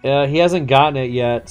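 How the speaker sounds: ordinary delivery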